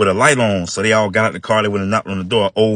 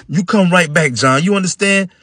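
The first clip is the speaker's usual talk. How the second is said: higher, louder firm orders; stern big brother; 9 semitones higher, 3 dB louder than usual. Acted. Mike warning John to come back, firm and loud. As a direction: lay down the rules to the little brother.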